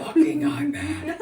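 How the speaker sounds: exasperated laugh. Real, not acted. Exasperated at iPad kids.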